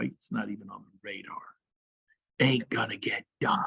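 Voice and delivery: breathy aside, barely voiced; whisper. Real, not acted. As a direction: whisper it like a secret warning. Coach drops to aside.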